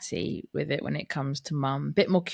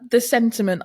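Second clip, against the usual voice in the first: raised voice; 7 semitones higher, 7 dB louder than usual. Retold or imitated. Auntie refusing nephew again.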